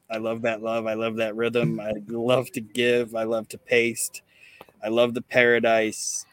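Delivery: ordinary talk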